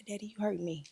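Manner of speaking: hushed broken whisper. Retold or imitated. Retelling calling her father from bathroom floor, whispered. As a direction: breathe it out barely voiced.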